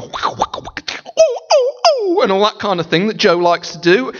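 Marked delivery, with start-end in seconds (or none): 0.0-0.6 whispered dubstep noise. Retold or imitated. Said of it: "Joe ... with his dubstep". 0.6-2.2 very high falsetto wobble sounds; high squealing beatbox of dubstep; dubstep vocal imitation. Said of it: "Joe ... with his dubstep".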